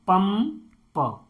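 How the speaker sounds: "पंप" slowly as पम, then प; "पंप" drawn out in two pieces; broken into sounds. Shown as a demonstration. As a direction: split off the last प of "पंप" after a gap. Teacher splits word into sounds.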